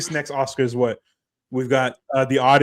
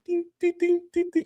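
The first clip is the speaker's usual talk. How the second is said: high sing-song ding ding imitating a piano note; mock piano dings; 4 dB quieter than usual. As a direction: plink out the dings like a mocking toy piano. Mocking the piano score.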